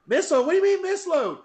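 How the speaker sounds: high-pitched incredulous question; acted-out indignant bafflement; retold disbelief. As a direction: jump way up in pitch, not believing it. Retold disbelief at misload news.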